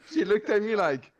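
ordinary talk, nothing marked

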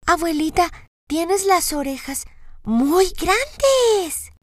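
niña aguda, muy lenta y asombrada; voz de niña. A narrator's reading. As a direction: pregunta curiosa de niña pequeña. Voz de Caperucita. (niña asombrada)

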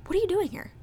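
confused, blunt question; mock confused question. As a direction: ask it baffled and flat. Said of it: "straight up look at him and be like".

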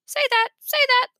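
high squawking parrot. A narrator's reading. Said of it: "parrot repeating".

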